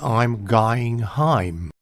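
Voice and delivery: "heim" for home, drawn out slowly; slow, exaggerated modern East End London vowels; modern East End accent. Shown as a demonstration. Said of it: "Now that sounds more like".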